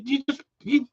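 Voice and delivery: ordinary delivery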